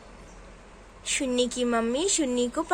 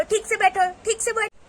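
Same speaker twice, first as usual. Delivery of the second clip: higher, cross command; stern mother; 7 semitones higher, 3 dB louder than usual. Acted. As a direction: order the child sharply. Mother orders child to sit. (सख्ती से डाँटती माँ)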